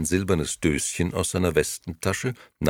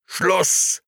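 high, loud bellow; explosive, irritated shout; exasperated yell; 5 dB louder than usual. A narrator's reading. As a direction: bark it to end the discussion. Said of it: "brüllte Gigi aufs Äußerste gereizt".

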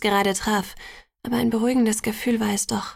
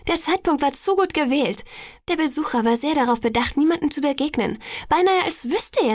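higher-pitched, insistent arguing; insistent doubt; 6 semitones higher than usual. A narrator's reading. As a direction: argue the suspicion with growing emphasis. Alice argues her suspicion to family. (eindringlich zweifelnd)

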